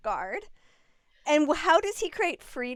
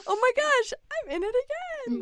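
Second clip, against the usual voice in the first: mock dismay; 10 semitones higher than usual. Partner catching herself back in the old pattern, mock dismay. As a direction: play up catching yourself out.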